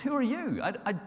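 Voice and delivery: high, fast bewildered protest; confused man protesting, pitched high; flustered Saul. Acted. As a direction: plead your innocence in a panic. Saul answering the heavenly voice.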